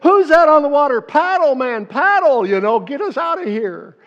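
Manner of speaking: high, loud frantic cries to paddle; panicked yelling. Retold or imitated. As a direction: scream at the crew to paddle away. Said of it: "disciples probably yelling at each other".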